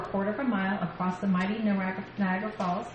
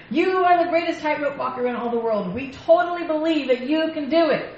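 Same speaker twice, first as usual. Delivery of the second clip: high, louder acted cheering voice; enthusiastic crowd; 7 semitones higher, 5 dB louder than usual. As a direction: gush like an adoring crowd. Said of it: "The crowd enthusiastically yelled".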